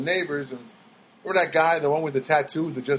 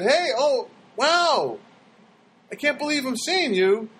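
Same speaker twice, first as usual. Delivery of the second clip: overeager greeting; 9 semitones higher, slower than usual. Retold or imitated. Greeting old acquaintance, overeager.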